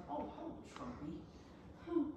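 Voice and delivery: high, slow cooing voice; high drawn-out hello to the cat. Retold or imitated. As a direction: greet the cat sweetly, drawn out. Greeting the cat in baby voice.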